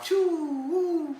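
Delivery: high, loud whoosh sounds like soaring; whooshing flying noises. Retold or imitated. Showing friends how he flew, high loud whooshes. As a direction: swoop through the air with a whistling choo and woo. Flying around on astral plane.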